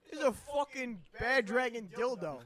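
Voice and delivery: high, loud, hammed-up indignation; comic furious disbelief; mock outrage. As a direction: yell it like an appalled parent in a sketch. Mock outraged parent in hospital.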